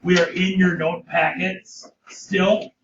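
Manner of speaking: normal talking voice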